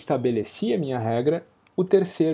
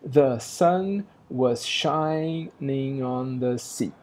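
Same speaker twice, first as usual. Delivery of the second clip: slow, each beat of "The sun was shining on the sea." marked; metrical reading; much slower than usual. Shown as a demonstration. Teacher scanning the line for students, slow and metrical. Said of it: "pensando metricamente".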